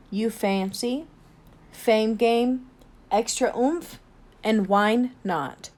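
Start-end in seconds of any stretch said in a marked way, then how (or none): none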